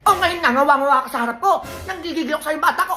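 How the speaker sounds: high, sharp nagging mom voice; scolding mother. Acted. Mother scolds her kid for eating sweets, high nagging voice. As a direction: nag the candy-eating kid from above.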